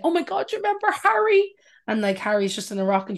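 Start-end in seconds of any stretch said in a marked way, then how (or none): 0.0-1.5 high, gushing fan voice, rising higher; mock nostalgic fan squeal; lightly voiced fan looking back fondly; future fans reminiscing. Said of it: "listening to him as, like".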